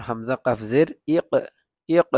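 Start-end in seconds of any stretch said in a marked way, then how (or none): 1.9-2.1 ইক with the ক struck and rebounding at the end; qalqala bounce. Shown as a demonstration. Teacher demonstrating the knocking qalqala on ইক. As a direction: hit the final ক of ইক so it bounces. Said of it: "একটা বাড়ি খাইছে না ইক বললে".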